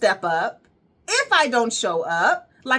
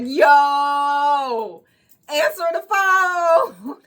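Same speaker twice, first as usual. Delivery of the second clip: loud, pleading shout; begging her to pick up, slow and loud; retold frantic call; urgent plea; 5 semitones higher, 6 dB louder, much slower than usual. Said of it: "I was like".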